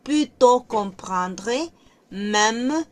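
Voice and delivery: reading French slowly for students; careful French read-aloud; slow demonstration reading. Shown as a demonstration. Reads French sentences aloud.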